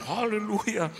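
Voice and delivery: hammed-up joyful hallelujah; gleeful hallelujah. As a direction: cry it out like a happy heir. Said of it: "Dia bilang".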